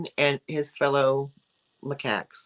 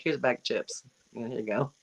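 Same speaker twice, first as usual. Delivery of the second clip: lower, softer friendly Scottish voice, lightly done; friendly Scottish imitation; 5 semitones lower, 6 dB quieter, faster than usual. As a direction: offer the chips like a kindly Scot, a bit lower. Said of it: "the Scottish be like".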